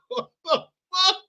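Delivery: high, loud, laughing through the words. Real, not acted. Vlogger cracking up at the Kamala clip, high and loud. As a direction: crack up while trying to talk. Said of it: "This is hilarious".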